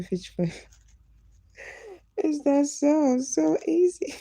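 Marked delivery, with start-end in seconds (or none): 1.9-4.1 high, loud, slow laughing question; laughing disbelief. Real, not acted. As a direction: laugh in disbelief at how easy it is. Laughs at red snapper story.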